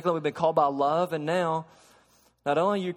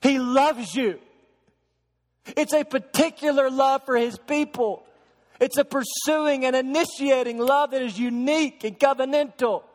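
higher and louder, proclaiming each phrase; full-force preaching; 8 semitones higher, 3 dB louder than usual. Acted. Pastor proclaiming God's love to the congregation, full preaching voice. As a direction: preach it out to the whole church.

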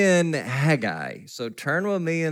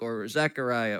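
usual speaking voice

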